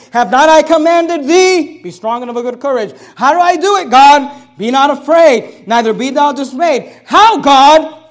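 loud, slow, booming scripture proclaimed to the congregation; preaching at full force. Acted. Pastor proclaims to his congregation, preaching at full force. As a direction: thunder each promise out from the pulpit.

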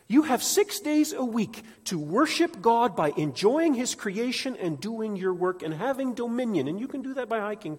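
high, loud impassioned preaching; preaching at full force. Acted. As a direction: preach it with rising fire.

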